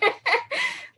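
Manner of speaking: high squeal of laughter; laughing. Real, not acted. Speaker laughing after her little boy impression. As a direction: burst out laughing.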